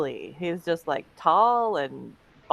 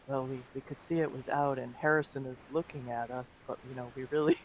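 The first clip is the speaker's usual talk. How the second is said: hushed, much softer commentator aside; quiet mock-secretive commentary; imitated hushed whisper; 5 semitones lower, 6 dB quieter, faster than usual. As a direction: lean in and murmur like a courtside commentator. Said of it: "like, whispering".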